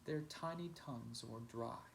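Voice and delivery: plain reading voice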